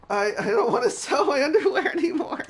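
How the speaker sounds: put-on high, loud girly whine broken by laughter; laughing girl voice. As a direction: whine it like the schoolgirl while cracking up. Streamer mimics girl, laughing.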